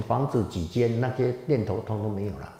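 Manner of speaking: ordinary talk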